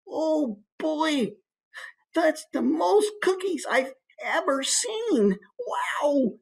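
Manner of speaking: put-on high, slow little-kid voice; wide-eyed little kid in awe; small child. Shown as a demonstration. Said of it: "It's a small child, obviously".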